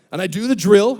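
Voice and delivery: ordinary talk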